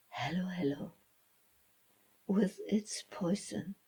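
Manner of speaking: ordinary delivery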